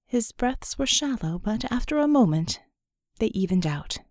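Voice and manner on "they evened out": ordinary talk, nothing marked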